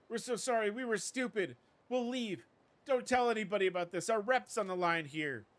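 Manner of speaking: high, loud, pleading apology; panicked apology. Acted. Beaten thugs begging the winners, high and panicked. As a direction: beg to be let off.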